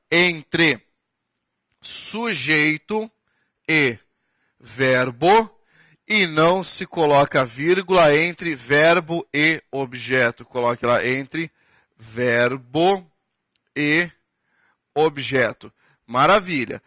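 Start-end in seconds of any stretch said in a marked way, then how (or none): 1.8-5.5 pauses between sujeito, e, verbo, slow; slow board dictation; spaced-out terms. Shown as a demonstration. Teacher spaces out grammar terms. 12.0-15.7 pauses between sujeito, e, verbo, slow; slow board dictation; spaced-out terms. Shown as a demonstration. Teacher spaces out grammar terms.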